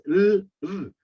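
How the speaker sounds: L and r sounded alone, held, with no vowel; isolated consonant sounds. Shown as a demonstration. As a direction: sustain the bare sounds of L, then r. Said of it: "otras que son muy sencillas".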